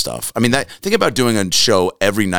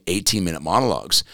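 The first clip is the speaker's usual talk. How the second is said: ordinary talk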